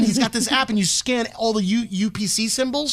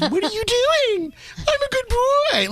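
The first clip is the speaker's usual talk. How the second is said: high squeaky talking parrot; 13 semitones higher, slower than usual. Retold or imitated. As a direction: squawk it like a pet parrot.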